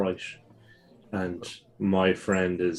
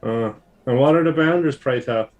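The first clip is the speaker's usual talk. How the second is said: higher, louder mock-polite; genteel inquiry; 6 semitones higher, 7 dB louder than usual. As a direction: ask with arch courtesy. Player character questions guard.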